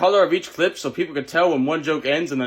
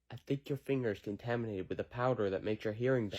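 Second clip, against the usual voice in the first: much softer, hushed; quiet; 6 semitones lower, 14 dB quieter than usual. Acted. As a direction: say it again, far quieter. Repeated line, growing quieter.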